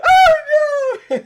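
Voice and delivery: hammed-up high, loud wail; mock crying. Player mimics the assistant crying, loud mock wail. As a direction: fake a huge bawling cry. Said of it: "Oh, she started crying".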